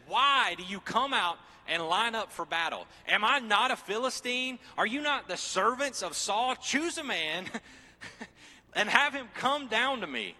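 loud, high booming taunt as Goliath; giant's challenge. Acted. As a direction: bellow it like a giant calling out an army.

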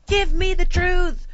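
hammed-up courtroom-drama demand; high, loud, slow movie outburst; Tom Cruise. Traveller playing a movie hero at the counter, dramatic shout. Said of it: "literally am like Tom Cruise".

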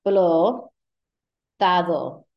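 explotado said with the D dropped, like explotao. Shown as a demonstration. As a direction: drop the D in explotado. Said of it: "we're often going to drop the D".

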